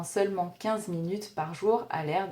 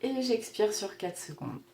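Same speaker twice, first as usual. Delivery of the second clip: guided breathing; 11 semitones higher, 11 dB quieter than usual. Acted. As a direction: count the breaths gently, barely above a murmur. (voix douce de respiration guidée)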